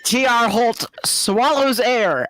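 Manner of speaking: dramatic reading. Acted. Reading with put-on voice.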